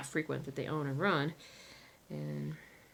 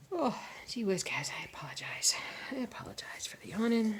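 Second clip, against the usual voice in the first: whispered apology; sorry-whisper to viewers; 3 semitones higher than usual. Real, not acted. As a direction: breathe the words. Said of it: "whispered apology".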